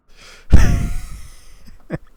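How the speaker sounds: loud high cackle; high shrieking laugh. Real, not acted. Cracking up at own joke.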